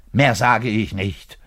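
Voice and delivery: ordinary delivery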